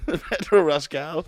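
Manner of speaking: slow, teasing sing-song; slow drawn-out. Real, not acted. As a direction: stretch the name out slowly.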